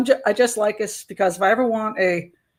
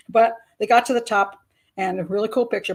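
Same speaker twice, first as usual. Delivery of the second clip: usual speaking voice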